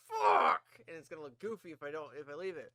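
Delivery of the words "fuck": loud, low cursing outburst; booming mock-frustrated yell; retold frustrated shout. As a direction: re-enact a big burst of dismay. Retold reaction to ruined beard.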